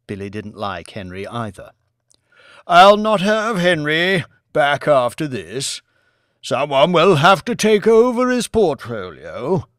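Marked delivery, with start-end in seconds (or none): 2.7-9.7 put-on old lord voice.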